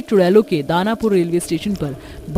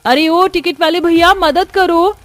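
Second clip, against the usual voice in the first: high, loud desperate plea; shouted appeal for rescue; calling for help; 11 semitones higher, 7 dB louder than usual. Acted. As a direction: cry out urgently to someone across the way. Victims cry out for help.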